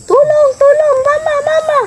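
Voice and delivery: put-on high, loud child's cry of tulong mama; child crying for help. Child imitating a sibling's panicked cry for mama. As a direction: mimic the little kid panicking on the ride. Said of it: "Ganyan siya talaga".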